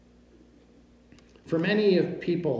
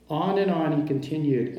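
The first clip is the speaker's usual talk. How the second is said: ordinary delivery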